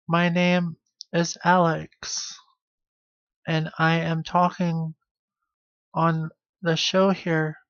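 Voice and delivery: slow, over-enunciated words for the captions; slow careful captions voice. Shown as a demonstration. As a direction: spell every word out slowly for the machine. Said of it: "I just have to speak like this".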